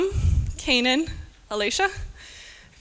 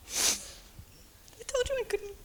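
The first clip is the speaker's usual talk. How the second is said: high, soft, slow words through tears; real tearful admission; crying; 12 semitones higher, 11 dB quieter, much slower than usual. Real, not acted. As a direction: let the tears break the line. Tearing up at core memory.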